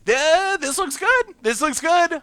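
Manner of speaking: high, pleased and eager; delighted with the draw; excited. Real, not acted. Thrilled by the vampire hand.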